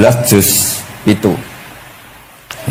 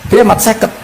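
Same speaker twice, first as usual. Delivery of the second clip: high, incredulous objection; protesting; 10 semitones higher than usual. Acted. Moses questioning fifty prayers for the people, protesting. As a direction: question it in a raised pitch. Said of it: "Bareng tok Nabi Musa bantah".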